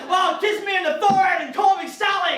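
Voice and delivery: high, loud hammed-up plea; intense true self. True me begging a date for affection, hammed-up desperate shout. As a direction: shout it with desperate mock passion. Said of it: "the real me is a very intense being".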